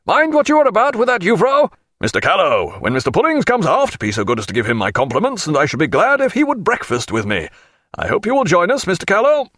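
high-pitched, hearty captain's call. A narrator's reading. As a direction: call it out high, as across a deck. Captain calling out, inviting to breakfast.

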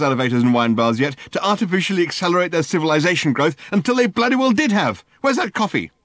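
high, loud exasperated rant; Ford's high, loud, fed-up rant. Acted. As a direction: rant it out in a fury. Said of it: "ranted Ford".

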